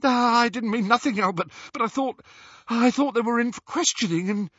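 high, flustered backpedalling; gasping apology. A narrator's reading. Balding wizard backs away from Runcorn, gasping. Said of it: "gasped the balding wizard, backing away".

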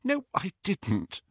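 high, slow, understated tearful denial. A narrator's reading. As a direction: deny it while holding back tears. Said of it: "still wiping her eyes on her sleeve".

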